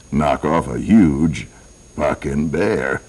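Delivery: normal talking voice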